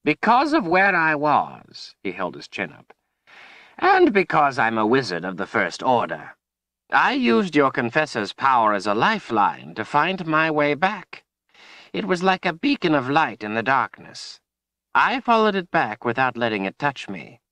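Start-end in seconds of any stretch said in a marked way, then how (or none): 0.0-2.0 put-on high, slow, emphatic old wizard; old wizard, self-important. A narrator's reading. 3.8-17.4 put-on high-pitched, emphatic old wizard voice; old wizard, proud. A narrator's reading.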